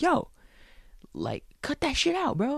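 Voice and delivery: retold yell. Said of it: "he yells at him".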